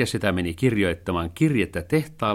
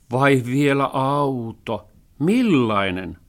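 slow, higher mocking question; sceptical cat; 5 semitones higher, 6 dB louder, slower than usual. A narrator's reading. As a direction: drag it out in disbelief. (epäilevä kissa)

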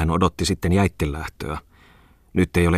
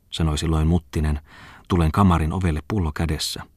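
ordinary delivery, nothing marked

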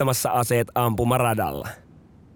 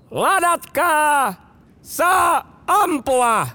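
high, very slow drawn-out shout; shouted command; 4 dB louder, much slower than usual. Retold or imitated. Officer commanding the firing line, drawn-out shout. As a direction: stretch each command out over the range. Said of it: "Sitten tulee käsky".

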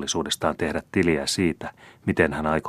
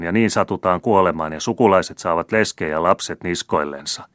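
put-on louder, scolding old merchant; grumbling old miser; 4 semitones higher, 6 dB louder than usual. A narrator's reading. Miserly old uncle lecturing Paul on debts, loud grumbling. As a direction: hammer home that poverty will come.

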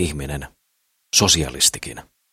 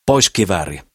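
a sharp, louder snap; snapping; 2 semitones higher, 5 dB louder than usual. Acted. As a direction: bark the order at him. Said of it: "tiuskahtelee Sakris".

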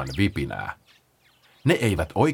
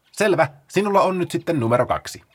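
high and quick, slowing at the end; eager Paula; 9 semitones higher, faster than usual. Acted. Paula rattles off the rules to the sloths, high and fast. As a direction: explain it like an excited organiser.

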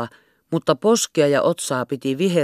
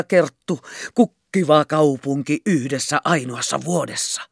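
rapturous. A narrator's reading. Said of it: "haltioituneessa raivonnassa".

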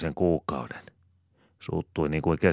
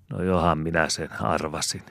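plain reading voice

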